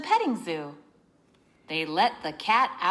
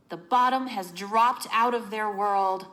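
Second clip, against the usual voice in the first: ordinary delivery, nothing marked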